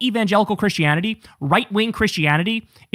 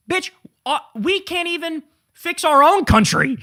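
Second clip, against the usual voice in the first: exasperated outburst; 9 semitones higher than usual. Real, not acted. Streamer reacting to a clip, higher and exasperated. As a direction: spell it out in disbelief.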